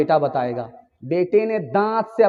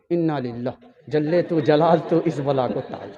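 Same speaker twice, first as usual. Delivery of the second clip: low mocking laugh; 5 semitones lower, 5 dB quieter than usual. Daughter describes mother-in-law.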